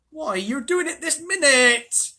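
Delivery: high, drawn-out bellow. A narrator's reading. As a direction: bellow the accusation as an exasperated dwarf. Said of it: "bellowed Trumpkin".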